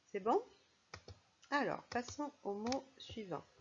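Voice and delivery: ordinary talk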